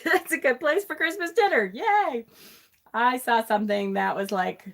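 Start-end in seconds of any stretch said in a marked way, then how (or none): 0.0-2.2 high, sing-out fake enthusiasm; mock cheer. Host joking about Christmas dinner in hell, mock cheer. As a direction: pitch it up in sarcastic delight.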